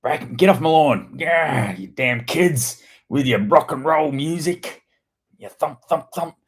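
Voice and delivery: put-on old man yelling at the kids to get off his lawn; grumpy old man. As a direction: wave a fist at the kids on the lawn. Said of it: "Back in my day".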